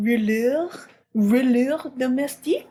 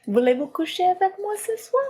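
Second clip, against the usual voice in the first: French accent; 9 semitones higher, faster than usual. Shown as a demonstration. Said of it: "I can't do French".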